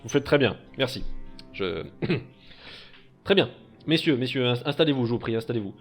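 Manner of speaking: loud, hearty rich merchant; jovial old master. Acted. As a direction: boom out a warm welcome.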